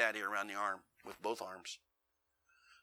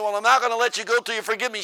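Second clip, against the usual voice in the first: high, loud stubborn repeat; preacher refusing to let go, loud insistence; 12 semitones higher, 15 dB louder than usual. Retold or imitated. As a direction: say it again, just as stubborn.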